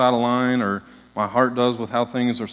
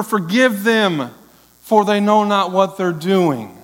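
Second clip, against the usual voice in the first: slow Jesus quote; 8 semitones higher, 5 dB louder, slower than usual. Acted. Jesus' last words quoted.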